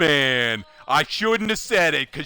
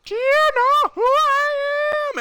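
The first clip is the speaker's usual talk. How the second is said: high, slow screech of do you know who I am; mock furious diva shriek; imitating a screaming customer; 4 dB louder, slower than usual. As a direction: scream it at the top of her lungs. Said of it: "at the top of her lungs".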